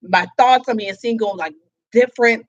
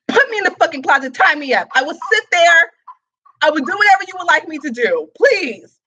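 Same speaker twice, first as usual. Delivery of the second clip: higher, louder, quick eager pleading; eager mock begging; 6 semitones higher, 4 dB louder than usual. Retelling how she begged her lover, in eager mock begging. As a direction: beg him, eager and a bit hammed-up.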